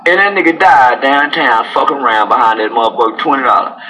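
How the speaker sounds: normal talking voice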